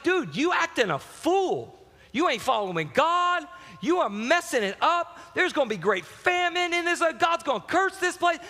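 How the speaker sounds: prophet's rebuke. Retold or imitated. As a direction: lightly act out a prophet calling out a king.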